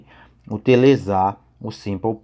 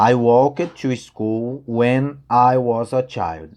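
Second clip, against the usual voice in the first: slow example sentence; much slower than usual. Shown as a demonstration. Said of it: "Então, ó".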